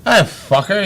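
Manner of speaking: ordinary talk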